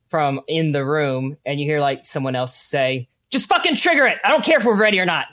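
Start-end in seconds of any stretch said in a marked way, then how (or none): 3.3-5.3 high, loud, rushed yell; desperate shout. A narrator's reading. Cornered leader screams to trigger it, fast and loud. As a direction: bark the order in panic.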